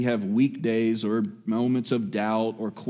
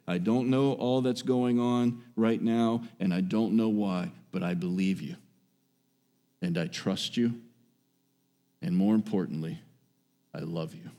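quiet prayer; 3 semitones lower, 6 dB quieter than usual. Pretend. Soft prayer voiced.

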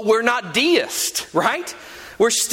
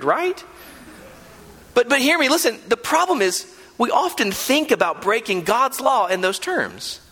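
ordinary delivery

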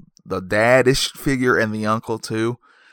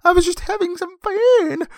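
much higher, squeaky pleading creature voice; crying leprechaun. Acted. Wounded leprechaun begs the woman not to shoot, high squeaky plea. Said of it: "he's crying out".

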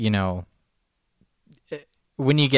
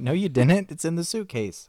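higher, sing-song mock protest; mock insistence; 8 semitones higher than usual. Replaying own side of the shirt argument, higher mock insistence. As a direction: playfully replay your own exasperated retort. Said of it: "And I'm like".

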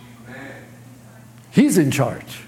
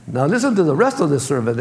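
ordinary talk

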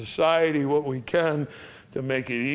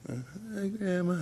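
small, higher, hushed greeting; timid, barely audible; 6 semitones higher, 6 dB quieter, slower than usual. Retold or imitated. Shy relative greeting dying Grandma, timid and barely audible. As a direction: imitate a shy mumble to Grandma, too soft to hear. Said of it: "You gotta say it so she can hear ya".

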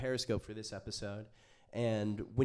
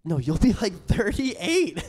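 high, slow teasing drawl; 9 semitones higher, 11 dB louder, slower than usual. Real, not acted. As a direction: stretch it out mockingly. Teasing friend about age.

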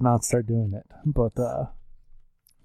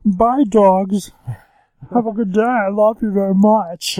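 high, loud silly voice; hammed-up voice pitched way up; impression of other humans; 9 semitones higher, 7 dB louder, slower than usual. Dad voicing other humans for his baby, silly high voice. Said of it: "that's your impression of other humans".

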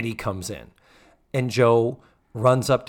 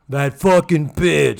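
loud, drawn-out insult; hammed-up angry outburst; mock breakup rage; 3 semitones higher, 7 dB louder, slower than usual. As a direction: spit it out like a bitter ex. Mimics an angry bitter breakup.

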